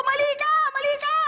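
high-pitched mock yell, no real volume; retold yelling. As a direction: act out yelling at the TV in protest. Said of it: "Sigaw ako na sigaw doon". (pasigaw kunwari, mataas ang boses)

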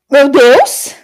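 loud startled cry of alarm; shocked gasp. Real, not acted. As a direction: react as if the story just horrified you. Shocked by the story's twist.

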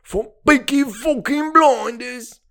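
slow Birmingham gangster voice from Peaky Blinders; a quick Thomas Shelby Birmingham growl, slowed down; lightly done Thomas Shelby in a Birmingham accent; Thomas Shelby impression. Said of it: "I can do fucking Thomas Shelby".

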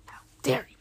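soft, whispery mock outrage; scandalized near-whisper; hushed indignation. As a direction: repeat the offence under your breath. Repeats the outrage under breath.

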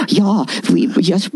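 badly faked Eastern European accent, a villager agreeing. Villagers answering the villain in a badly faked Eastern European accent. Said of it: "that's not Eastern European".

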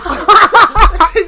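high, loud helpless laugh; telling her friends the prank story, she bursts out laughing; burst of laughter. Real, not acted. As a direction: crack up out loud. Cracks up mid-story.

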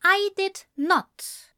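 high, slow, deliberate refusal; firm denial. A narrator's reading. Man contradicts friend's claim of love, firmly. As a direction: set each word down firmly. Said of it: "Ezra replied firmly".

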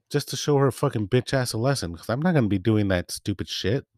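ordinary delivery

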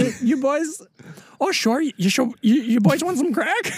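high-pitched Minnesota-nice greeting; Midwest accent. Pretend. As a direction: put on a chipper Midwestern accent. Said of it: "like, a Midwest".

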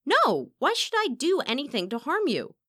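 high, loud, anguished denial; urgent, upset outburst; distressed protest. Acted. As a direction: plead innocence with rising alarm. Accused singer protests her innocence.

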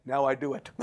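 low, understated acted reply; deadpan comeback. Husband answering his scolding wife, low and matter-of-fact. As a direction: drop low for the punchline.

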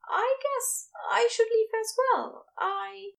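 high, hesitant voice for a nervous man; nervous Ezra voice. A narrator's reading. Ezra to Crowley, offering to leave, nervously.

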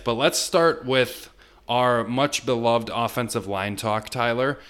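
ordinary talk

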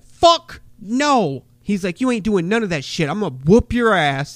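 loud, high furious snap; angry refusal. Retold or imitated. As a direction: bark it at the rookie. New Jack threatening new wrestler.

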